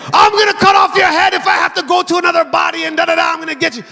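put-on high, rushed Jamaican-accented demon threat; demon's threat. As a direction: rattle off the threat as a possessed man. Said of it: "demon-possessed guy one time".

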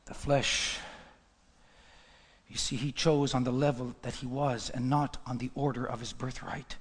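low and measured, gradually firming up; grave, subdued preaching. Real, not acted. Preacher lamenting Esau's low choice, low and grave. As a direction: bring it right down, then press the point.

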